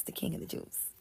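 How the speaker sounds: King whispered under the breath; whispered in awe; hushed. Shown as a demonstration. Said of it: "which he is".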